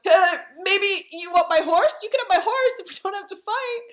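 very high, quickening cowardly pleading; mock freak-out. Cowardly Andrew bargaining out of the fight, high mock panic. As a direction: squeak out a hammed-up nervous bargain. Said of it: "is freaking out".

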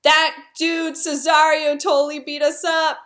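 high-pitched tattling whine; mock aggrieved complaint. Andrew and Toby reporting a beating, high mock complaint. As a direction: complain like a hammed-up injured party. Acted complaint by beaten-up pair.

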